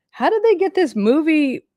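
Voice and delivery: high-pitched mock astonishment; retold amazement. Telling viewers about her reaction to the movie, amazed.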